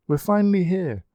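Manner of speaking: high, bright exclamation of arrival; exclaiming. A narrator's reading. Marguerite tells the group they've arrived, excited. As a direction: announce it with relief and glee. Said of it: "Marguerite exclaimed".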